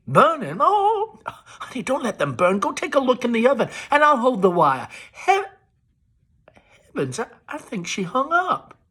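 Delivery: high, bright-pitched Southern society chatter into the phone; Amanda on the phone. A narrator's reading. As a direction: gush to an old friend on the telephone. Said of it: "Amanda enters with the telephone".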